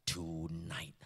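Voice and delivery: a breathy, unvoiced "Tonight"; whispered warning. Preacher voicing God's warning to the rich man, whispered. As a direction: breathe "Tonight" like an ominous secret.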